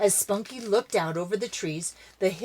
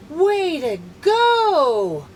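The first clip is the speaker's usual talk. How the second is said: higher, louder, slow cheer; 8 semitones higher, 8 dB louder, much slower than usual. Acted. Missus Dobson cheers the rescue, loud cheer. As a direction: cheer them on. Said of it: "cheered Missus Dobson".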